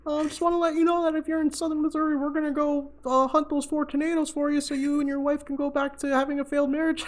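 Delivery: high-pitched, fast, mocking phone taunt; squeaky cocky jerk needling his rival; smug rival on phone. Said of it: "And he's like".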